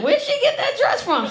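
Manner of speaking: high-pitched, tickled imitation of a son; acting out an amused teenage son. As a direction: ask it as if it's hilarious, voice way up. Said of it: "He think it's funny".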